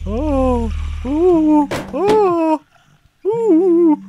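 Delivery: high, loud "oh" and "ooh" yelps; string of high-pitched whoops; startled cries. Real, not acted. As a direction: yelp in rising startled bursts. Startled cries during a game scare.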